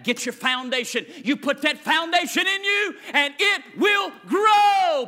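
building from steady to a loud, high cry; preach. Acted. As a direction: swell it up to a shouting climax. Preaching at full force.

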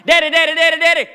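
mock kid shouting for daddy; whiny kid calling. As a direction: call out like an insistent kid. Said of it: "[name] will go".

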